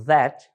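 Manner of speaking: "that" said slowly, opening on a D sound like "dat"; drawn-out "that" with the TH as a D; slow "that" starting with a D, like "dat". Shown as a demonstration. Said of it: "Reparem na pronúncia".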